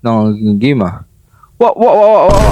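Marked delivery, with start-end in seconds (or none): 1.6-2.5 startled yelps. Real, not acted. Startled by horror game monster.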